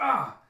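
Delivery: short, loud, high cry of pain; acted yelp at being stabbed; pained scream. Voices Jim hit by a knife.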